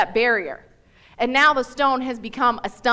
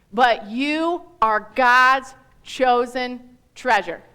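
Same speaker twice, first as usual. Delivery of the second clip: slow reading; slower than usual. Shown as a demonstration. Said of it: "We're gonna read it slow".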